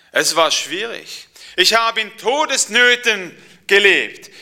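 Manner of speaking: Paul telling the preacher of his hardships; old Paul recounting suffering. Acted. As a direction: pour out every trial with growing force.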